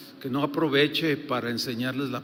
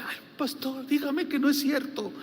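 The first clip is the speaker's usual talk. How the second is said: a lightly voiced tearful sister, higher; mild imitation of a crying woman begging; tearful plea; 10 semitones higher than usual. Sister begs her pastor, mildly tearful imitation. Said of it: "ella lloraba".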